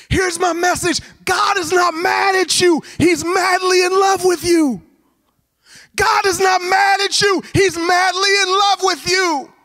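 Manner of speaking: very high, sustained proclaiming shout; full-force preaching. Real, not acted. Preacher proclaiming God's love to the congregation, full-force shout. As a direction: shout it out as the heart of the sermon.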